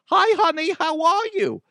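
high-pitched put-on mom greeting; mama voice. Host showing his mama voice to listeners, high mock mom. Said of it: "when I do my mama voice".

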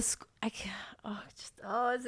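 breathy whisper, lost for words. Real, not acted. Telling friends about the school chaos, whispered. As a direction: whisper in disbelief. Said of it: "speaker trails into whispers".

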